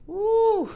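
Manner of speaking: high, loud woo; excited whoop. Real, not acted. Tarot reader reacting to the cards, excited whoop. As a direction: let out a high whoop at the reveal.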